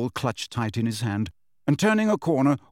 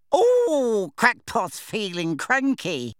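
much higher, slow, singsong taunt; mocking poltergeist; 10 semitones higher, much slower than usual. A narrator's reading. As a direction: tease him from above. Peeves taunts Harry.

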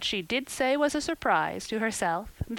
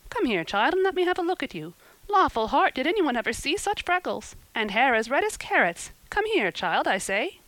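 outspoken woman, higher and lively; bossy, pitched-up fussing; blunt exclaiming; 6 semitones higher, 3 dB louder than usual. A narrator's reading. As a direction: exclaim bluntly over what you see. Blunt woman exclaiming over child's looks.

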